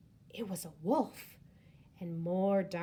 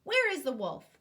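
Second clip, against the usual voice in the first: raised, puzzled question; asking; 7 semitones higher, 6 dB louder than usual. Acted. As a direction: ask it searching around. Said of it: "they asked".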